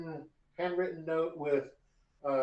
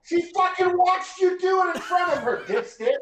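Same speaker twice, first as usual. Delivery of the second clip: laughing exasperation; 8 dB louder than usual. Real, not acted.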